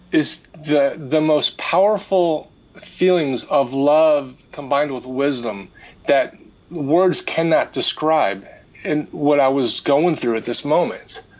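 normal talking voice

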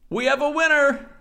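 high, loud game-show winner call; jokey, high-pitched announcement; mock announcer. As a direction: proclaim it like a contest host.

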